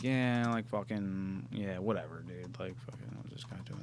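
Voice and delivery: low, very soft, offhand mumble; unimpressed monkey. Acted. Imitating a monkey watching a magic trick, unimpressed. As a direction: shrug it off under your breath.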